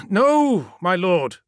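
high, loud cry of no; loud refusal easing into deference; emphatic denial. Acted. Ammon contradicts his lord.

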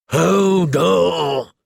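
drawn-out cry of exertion; effortful cry. A narrator's reading. Hodor straining under the slanted door, effortful cry. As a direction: push the word out with the heave.

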